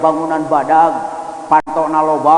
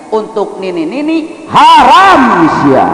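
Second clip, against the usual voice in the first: mock stern shout; 6 semitones higher, 5 dB louder, slower than usual. Preacher jokes grandmas are banned.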